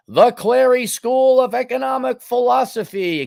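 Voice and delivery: high, grand heroic proclamation; mock heroic. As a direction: proclaim the title like a hero. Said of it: "I wanted to sound very heroic".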